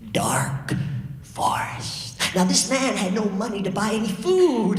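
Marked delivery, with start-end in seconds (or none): 0.1-2.2 spooky whisper. A narrator's reading.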